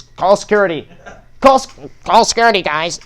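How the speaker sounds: panicked teacher shouting for security; teacher calling out. Retold or imitated. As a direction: yell for security like a flustered teacher.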